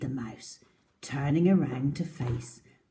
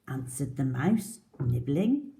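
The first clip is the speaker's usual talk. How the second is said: usual speaking voice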